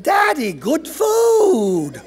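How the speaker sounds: put-on high, loud, stretched-out kid voice; eager child's shout. A narrator's reading. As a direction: holler it proudly to dad, drawn out. Child begs father for treats.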